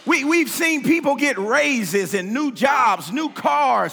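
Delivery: full-force preaching. Acted.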